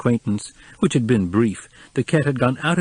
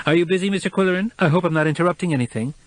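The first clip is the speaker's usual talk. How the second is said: higher, quick fussy woman; reedy woman's voice; 8 semitones higher, faster than usual. A narrator's reading. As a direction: chatter it fast in a reedy voice. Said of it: "reedy voice of Charlotte Roop".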